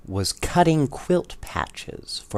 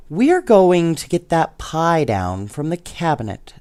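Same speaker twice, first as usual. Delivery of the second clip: 1 toys' voice; 7 semitones higher, 4 dB louder than usual. A narrator's reading. Toys state their plan.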